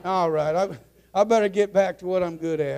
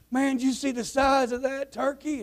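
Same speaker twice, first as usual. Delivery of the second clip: higher-pitched mock astonishment; acted amazement; 8 semitones higher than usual. Man from Arkansas points out a turkey to a Texan, amazed. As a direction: marvel at it like an impressed traveler. Joke character exclaims at huge turkey.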